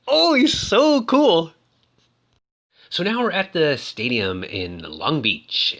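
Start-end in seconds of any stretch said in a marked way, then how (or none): 0.1-1.5 high, loud, drawn-out gush; over-the-top fawning; gushing admiration. Real, not acted. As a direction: swoon like a fan, slow and high. Gushing over Evel's wink.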